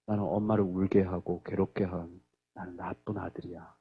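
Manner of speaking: put-on low, soft voice of a sorry child; imitated remorseful confession. Son admitting he makes mother cry, imitated quiet remorse. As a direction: own up quietly and heavily.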